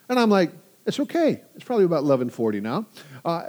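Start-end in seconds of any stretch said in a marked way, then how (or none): 0.8-1.4 soothing dad. Retold or imitated. Dad soothing daughter on phone.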